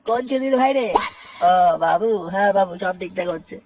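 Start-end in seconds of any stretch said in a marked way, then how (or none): 1.5-3.6 put-on much lower, humble old workman's voice; old mistri. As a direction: answer the boss like a deferential old labourer. S1 plays the old stage mistri. (নিচু গলায় বিনীত বুড়ো মিস্ত্রি)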